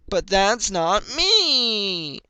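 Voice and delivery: high, loud, stretched protest; cried out. A narrator's reading. Filly protesting loudly to her mother. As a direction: cry it out in frustration. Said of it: "Applejack cried".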